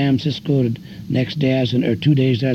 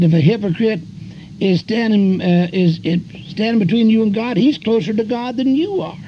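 fired-up preacher's comeback; retold retort; 9 semitones higher than usual. Preacher snapping back at a guy about hypocrites, raised and ringing. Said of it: "I said".

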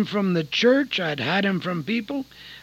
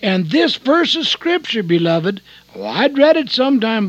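usual speaking voice

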